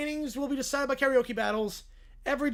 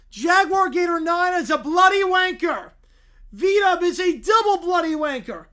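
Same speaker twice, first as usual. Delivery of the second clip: put-on loud, higher child's yell; kids yelling; 6 semitones higher, 9 dB louder than usual. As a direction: bellow the insult like a cheeky kid. Kids yelling insults on the radio show.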